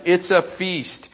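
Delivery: usual speaking voice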